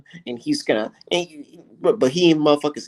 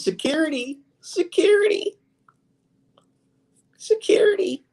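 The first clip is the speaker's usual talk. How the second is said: high, breathy, whispered mock cries; mocking panicked call for security. Streamer mocking an analyst calling for security, high panicked whisper. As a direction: imitate a frightened man calling for guards. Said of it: "Said he gonna call the police on me".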